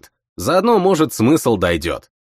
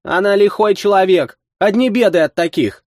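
indignant woman; 9 semitones higher than usual. A narrator's reading. Vasilisa objects.

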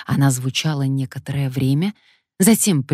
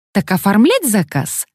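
enticing sales voice; 6 semitones higher, 4 dB louder than usual. A narrator's reading. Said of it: "завлекающий голос".

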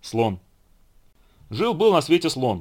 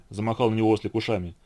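ordinary delivery

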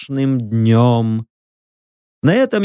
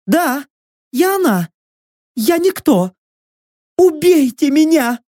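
high, desperate prisoner voice; tormented captive woman; 11 semitones higher than usual. A narrator's reading. Prisoner confessing who she is, desperate high voice. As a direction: answer high, rising to a plea.

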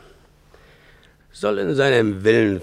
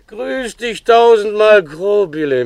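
much louder and higher, an overdone friendly hello; forced cheerful greeting; 12 semitones higher, 8 dB louder than usual. Acted. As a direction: greet your wife with a put-on sweetness. Freundliches Gesicht machen.

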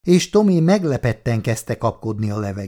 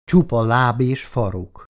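ordinary delivery

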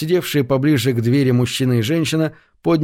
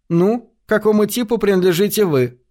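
cheeky; 7 semitones higher than usual. A narrator's reading. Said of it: "нахально спросила Шерил".

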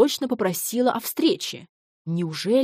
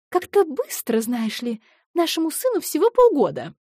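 anxious; 8 semitones higher, 4 dB louder than usual. Acted. Жена делится тревогой.

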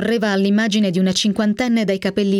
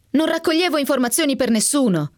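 indignant, voice climbing high; raised voice; 7 semitones higher than usual. A narrator's reading. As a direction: voice pitched well up, pressing the denial. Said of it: "dissi alzando la voce".

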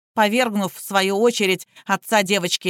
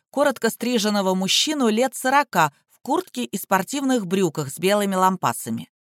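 ordinary delivery

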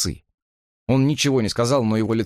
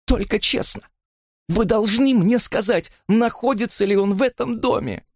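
desperate plea; 13 semitones higher than usual. A narrator's reading. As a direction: beg for the truth in a raised pitch. Desperate plea after voice broke. (отчаянная мольба высоким голосом)

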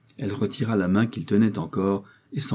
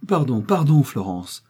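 louder, higher plea for forgiveness; cried out; 4 semitones higher, 4 dB louder than usual. A narrator's reading. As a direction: burst out begging pardon. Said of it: "s'écria-t-il".

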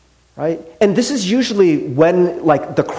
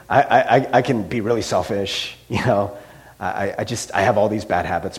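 low, softer acted self-admission; self-aware jerk; 8 semitones lower, 4 dB quieter than usual. Someone admitting bad habits, acted low-key resignation. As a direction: list your faults offhand, pitched well down. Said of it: "they know what they are".

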